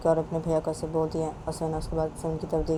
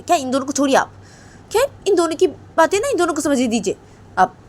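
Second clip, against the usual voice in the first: wife telling off her husband in a raised pitch; exasperated mother; 10 semitones higher, 9 dB louder than usual. Acted. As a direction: insist impatiently that he back off. Plays mother hushing father. (पति पर झुँझलाती माँ की आवाज़)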